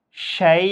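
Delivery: শাই with the আই drawn out about one alif; stretched vowel. Shown as a demonstration. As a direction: hold the আই of শাই long at the pause. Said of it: "এভাবে উচ্চারণ করতে হবে".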